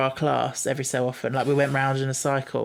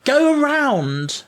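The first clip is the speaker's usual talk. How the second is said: louder, much higher, drawn-out yell; exasperated call; 12 semitones higher, 5 dB louder, much slower than usual. Acted. Theatre person telling Martha off, exasperated yell.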